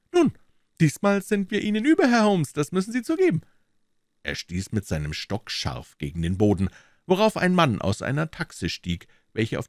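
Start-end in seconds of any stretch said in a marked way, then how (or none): 0.1-3.4 high, fairly loud character voice; triumphant police detective. Acted. Detective claims he is ahead of Holmes, high triumphant voice. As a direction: crow a little in the detective's high voice.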